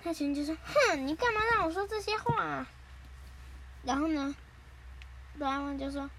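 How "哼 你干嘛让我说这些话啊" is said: high, put-out cartoon complaint; indignant protest. Nobita asks why he was made to say it, indignant. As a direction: object in a huffy high voice.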